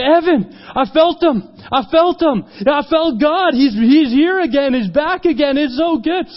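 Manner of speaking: high, loud, breakthrough cry; elated, full-voiced testimony; overjoyed. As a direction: exclaim it like a joyful discovery. Youth overjoyed after prayer.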